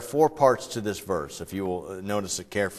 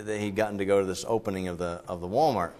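ordinary talk, nothing marked

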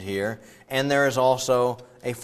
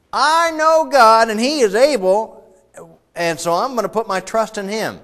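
confident believer; 11 semitones higher, 9 dB louder than usual. Retold or imitated. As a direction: proclaim it like a simple believer. Preacher acting out a believer.

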